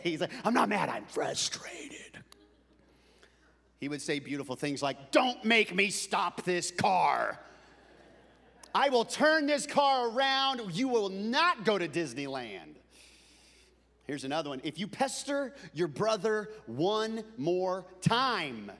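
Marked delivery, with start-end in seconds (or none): 0.4-2.2 slow, strained whisper of frustration; imitating seething dad. Dad insisting he isn't mad, seething whisper. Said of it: "being frustrated to where you wanna kick". 5.1-12.8 slow, loud, emphatic warning; imitating threatening dad. Dad threatening kids from the driver's seat, stern and loud. 14.7-18.7 slow warning, higher pitched; imitating stern dad. Dad warning a pestering kid, slow and stern.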